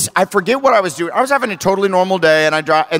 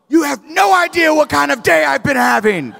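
raised-pitch mock yell, held short of screaming; retold scream; 6 semitones higher than usual. Storyteller yelling back at the other driver, mock indignant scream. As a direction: protest in a high, indignant mock shout. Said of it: "I screamed that at him".

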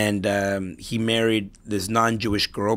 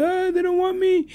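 mock wounded whine. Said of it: "he's like".

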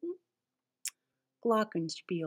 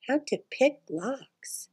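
softly whispered title; whispering; 5 semitones higher, 7 dB quieter than usual. Acted. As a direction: whisper it secretly. Secret book title.

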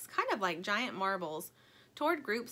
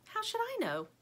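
high-pitched protest; defensive retort; 13 semitones higher than usual. A narrator's reading. Stingray answers Lumpy's question, defensive. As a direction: throw the question back, high.

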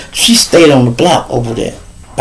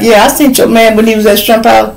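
high, lightly put-on woman's voice; gossiping girlfriend; 9 semitones higher, faster than usual. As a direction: tattle it with relish, pitched up. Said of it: "her girlfriend like".

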